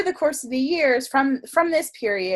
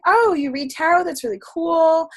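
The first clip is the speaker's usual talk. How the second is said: higher, mildly gushing quote; acted enthusiasm; 7 semitones higher, 4 dB louder than usual. Artsy acquaintance reacting to tarot reading, acted enthusiasm. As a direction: imitate an impressed new acquaintance. Voices enthusiastic girl on meeting her.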